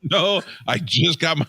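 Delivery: high-pitched, defensive objection; indignant protest. Real, not acted. Host accused of robbing a homeless guy, indignant. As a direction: protest your innocence in a high voice. Protests being called a robber.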